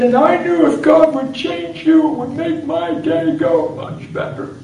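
very high, loud, slow mock-crying; hammed-up high sobbing voice; tearful confession. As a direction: wail it out, imitating a weeping wife. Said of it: "She said".

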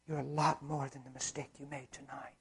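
very soft, low, near-whispered encouragement; hushed reassurance. Retold or imitated. Preacher retelling his words to a handcuffed boy, hushed reassurance. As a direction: drop to a tender hush.

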